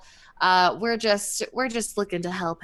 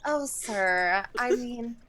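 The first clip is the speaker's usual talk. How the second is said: breathy damsel plea, lowered voice; playing helpless; 6 dB quieter than usual. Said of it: "in our low-cut tops".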